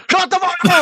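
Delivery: high-pitched shout through laughter; high laughing shout. Pretend. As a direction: yell it back, cracking up. Laughing echo.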